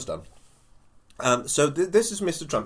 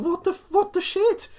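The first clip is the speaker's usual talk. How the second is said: high, loud, incredulous outburst; hammed-up exasperated yelling; exasperated Turnbull; 6 dB louder than usual. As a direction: squawk it in disbelief, voice way up. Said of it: "this is Mister Turnbull going".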